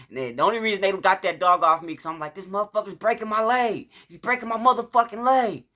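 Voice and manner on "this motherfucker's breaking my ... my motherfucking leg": high-pitched mock scream of pain; retold screaming. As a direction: wail it, building on the second sentence. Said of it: "when I'm screaming".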